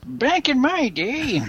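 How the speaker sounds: high, slow old-timer impression; old man voice. Obliging co-hosts who love it, hammed-up old man voice. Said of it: "I love the old man voice".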